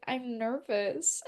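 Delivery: soft, drawn-out shy confession; nervous admission. Retold or imitated. Shy fan confessing nerves to the guys, small slow voice. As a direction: admit it slowly and quietly. Admitting nerves to the attractive group.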